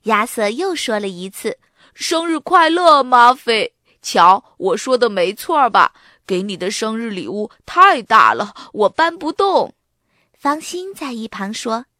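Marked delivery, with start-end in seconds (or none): 1.9-9.7 put-on loud boy character voice; 得意地祝贺. A narrator's reading. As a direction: proudly greet the birthday friend, louder. Boy greets friend at surprise party. (男孩大声得意地说)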